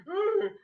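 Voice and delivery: high, laughing, guilty ugh; squeaky laughing groan; caught groan. Retold or imitated. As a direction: groan like a kid who just got busted.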